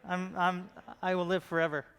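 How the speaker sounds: usual speaking voice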